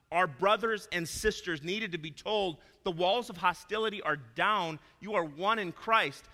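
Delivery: loud, high pulpit voice building to a slow climax; impassioned sermon peak; preaching at full force. Acted. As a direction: thunder it from the pulpit. Preaches the Colossians message.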